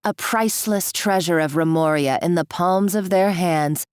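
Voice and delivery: normal talking voice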